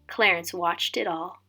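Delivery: plain reading voice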